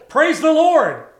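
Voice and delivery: loud, high cry of praise; triumphant preacher's exclamation; exuberant praise. Retold or imitated. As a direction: proclaim it loudly, pitch lifted. Retold shout of praise to onlooker.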